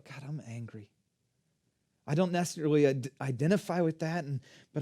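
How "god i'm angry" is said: low, hushed admission to God; subdued, private prayer; quiet prayer. Shown as a demonstration. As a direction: confess it softly under your voice.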